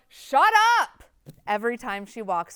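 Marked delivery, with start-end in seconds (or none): 0.1-1.0 high, loud shout; yelling. Retold or imitated. Student passing noisy construction workers, yelling. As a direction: yell it sharply at the noise. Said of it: "the girl that yells".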